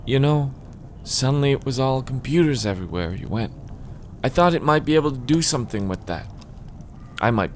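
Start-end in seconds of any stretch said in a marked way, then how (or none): 0.1-3.5 lightly voiced novelist, slightly raised pitch; a hint of a nostalgic character; wistful former romance writer. Reader plays Amy, novelist. 4.2-6.3 higher, lightly voiced novelist; wistful former romance writer. Character take, then reread.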